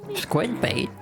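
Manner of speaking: high offended echo; outraged at the nickname; indignant squeak. Real, not acted.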